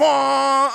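air horn. Retold or imitated. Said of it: "I'm gonna go air horns".

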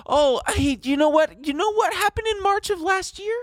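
high mock-surprised reveal; sarcastic high gasp at buybacks; mock excitement. Mock revelation, high put-on voice.

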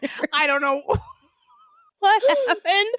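can barely get the words out for laughing; laughing quote. Retold or imitated. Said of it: "I couldn't stop laughing".

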